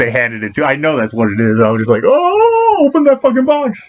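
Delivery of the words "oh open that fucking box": high, drawn-out squeal of eagerness; hammed-up fan begging, pitch way up; impatient excitement. Acting out eagerness to see the box.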